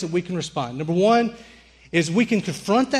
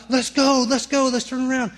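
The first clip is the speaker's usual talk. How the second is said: panicked; 7 semitones higher than usual. Retold or imitated. Begging friend to flee ghosts.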